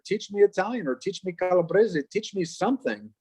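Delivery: retold pleading. Grandchild begging grandfather for language lessons, mild retold pleading. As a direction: lightly act out a grandchild's repeated request. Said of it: "I kept asking my grandfather".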